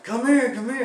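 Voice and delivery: high, loud call to a friend; yelling for a friend to come help; urgent calling. Retold or imitated. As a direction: wave him over, shouting.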